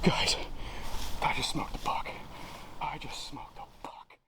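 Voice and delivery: excited whisper to camera; breathless hunter's whisper. Real, not acted. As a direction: hush it, deer nearby. Whispering on stand after kill.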